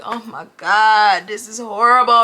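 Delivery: slow, drawn-out mortified groan; slow horrified mutter to yourself; dread-filled groan. Retold or imitated. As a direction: stretch it out like you want to disappear. Dread seeing the bad ex-date.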